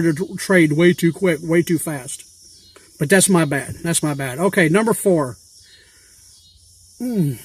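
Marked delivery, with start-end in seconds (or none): 3.8-4.4 a muttered second admission, trailing off; quiet repeat. Real, not acted. To viewers, repeating the admission quietly to himself. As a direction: drop it softer, half to yourself. Admitting fault about boat trade.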